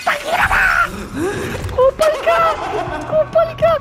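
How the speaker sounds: high, yelled insults; angry cursing. Real, not acted. Player cursing at whatever attacked him, high angry yelling. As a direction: hurl curses at the enemy.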